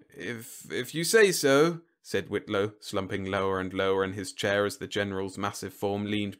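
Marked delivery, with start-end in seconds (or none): 0.1-1.8 mild, halting hesitation, slowing down; hesitant. Acted. Whitlow giving in to the looming general, hesitant.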